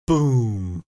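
normal talking voice

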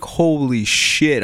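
slow, stunned holy shit; retold disbelief; mock shock. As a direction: let it sink in slowly. Driver realising the accident.

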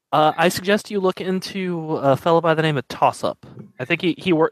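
usual speaking voice

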